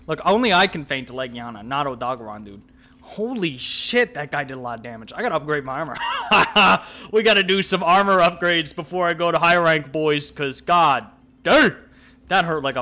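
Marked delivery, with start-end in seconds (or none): 6.0-7.1 short loud real laugh; burst of laughter. Real, not acted. Gamer laughing after a monster nearly kills him. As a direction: laugh out at the hit you took. Laughs at heavy damage hit.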